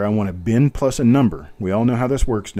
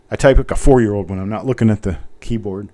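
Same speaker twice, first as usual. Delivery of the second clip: ordinary delivery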